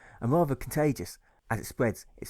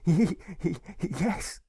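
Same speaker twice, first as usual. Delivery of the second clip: put-on giggly little boy; 8 semitones higher than usual. A narrator's reading. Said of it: "Alfred replies with a giggle".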